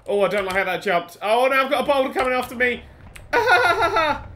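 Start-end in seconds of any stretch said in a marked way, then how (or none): 3.3-4.3 high, loud yelps into frantic words; panicked shrieking. Real, not acted. Player chased by a boulder, panicked yelling.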